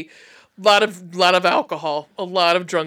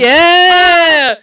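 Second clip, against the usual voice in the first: excited; 9 semitones higher, 10 dB louder than usual. Real, not acted. Both hosts cheer Evil Dead month.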